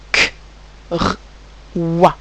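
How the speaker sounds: K, R, WA as three isolated sounds with pauses. Shown as a demonstration. Teacher showing pupils three sounds, sound by sound. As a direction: sound out K, then r, then wa separately. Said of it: "j'entends trois sons".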